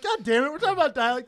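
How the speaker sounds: loud, high exasperated yell; frustrated outburst. Retold or imitated. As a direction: blurt it out, fed up. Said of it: "I was like".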